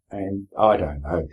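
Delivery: normal talking voice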